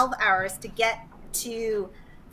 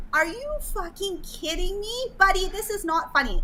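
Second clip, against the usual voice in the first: mad, imitated; 7 semitones higher than usual. Woman retelling her reply to friend calling from jail, mad. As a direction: retell your disbelieving anger, pitched up. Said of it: "I was so mad".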